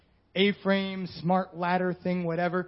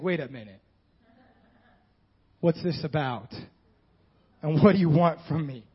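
much lower, suspicious and guarded; wary Mary; 5 semitones lower than usual. Retold or imitated. Mary questioning the angel, low and suspicious. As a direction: ask warily, what's the catch. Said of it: "she's like".

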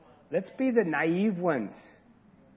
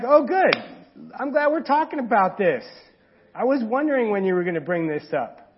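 delighted God; 4 dB louder than usual. Pretend. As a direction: burst in glad the topic finally came up. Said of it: "He's probably like".

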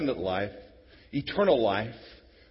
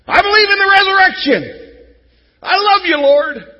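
much higher, loud gushing praise; exuberant believer; 17 dB louder than usual. Acted. Hypocritical believer gushes to the Lord, loud high praise. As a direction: overdo the praise, all show.

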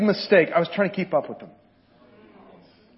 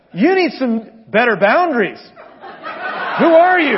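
retold retort; 5 semitones higher, 7 dB louder than usual. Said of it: "And I said".

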